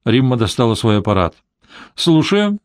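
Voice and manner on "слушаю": high, cheerful phone greeting; light, high and merry. A narrator's reading. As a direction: answer the call merrily, pitched up. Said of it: "весело сказала она".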